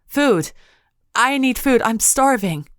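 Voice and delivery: high, loud pleading shout; desperate shouting. A narrator's reading. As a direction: beg with all your strength. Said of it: "clinging to them in desperation".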